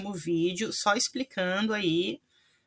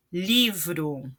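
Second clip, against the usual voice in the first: Livro set apart, weight on Li; stress on first syllable. Shown as a demonstration. Teacher returning to the example, Livro stressed on Li. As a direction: stress LI in Livro, letting vro fall away. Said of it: "li é a sílaba tônica".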